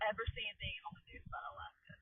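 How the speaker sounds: fast unvoiced whisper. Real, not acted. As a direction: whisper it quickly aside. Whispered aside.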